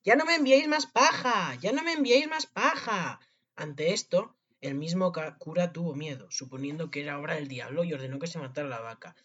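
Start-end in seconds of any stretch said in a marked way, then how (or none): 0.0-3.2 high-pitched, drawn-out yell; shouting. A narrator's reading. Tom Thumb cries from inside the cow, shouting. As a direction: plead at the top of your voice. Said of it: "se puso a gritar de nuevo".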